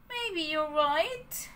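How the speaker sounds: slow, resigned sigh in the voice. Acted. Pinocchio admits to Jiminy, sighing. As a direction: give in with a sigh. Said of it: "said with a sigh".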